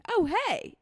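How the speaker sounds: lifted "oh hey" way up high; playful imitated hello; high bright greeting. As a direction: greet him with a sing-song lift. Guy greets her at bar.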